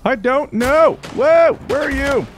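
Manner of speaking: high, loud flustered wail; frantic gamer panic; panicked yelling. Real, not acted. Lost, hunting enemy in game.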